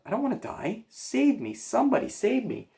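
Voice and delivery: high, desperate cry for help; panicked pleading. A narrator's reading. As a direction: beg for your life, pitch way up. Terrified pig begging for rescue.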